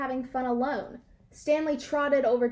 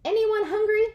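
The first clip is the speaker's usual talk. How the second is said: much higher, louder offer; cheery host call; 8 semitones higher, 5 dB louder than usual. A narrator's reading. Dog host offers the fridge to guests, high and loud. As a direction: call out to the crowd. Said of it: "asked Stanley".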